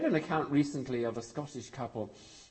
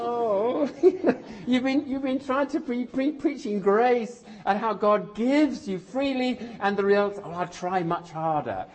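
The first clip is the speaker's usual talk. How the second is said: higher, laughing through the words in fond exasperation; laughing disbelief; 8 semitones higher, 3 dB louder than usual. Retold or imitated. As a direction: chuckle in disbelief at a friend who missed the point. Exasperated inner thought, laughing.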